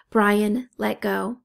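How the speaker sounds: usual speaking voice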